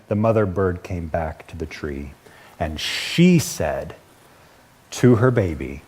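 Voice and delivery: low, very slow and weighty; solemn story reading. A narrator's reading. Preacher reading a deeply spiritual picture book, solemn and slow. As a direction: read it with long, reverent pauses.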